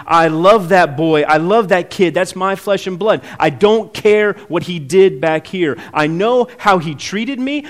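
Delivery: loud, passionate declaration of a father; father's fervent love. Acted. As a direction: cry it out like a father for his son. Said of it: "That's saying".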